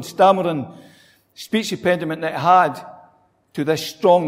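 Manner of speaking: ordinary talk, nothing marked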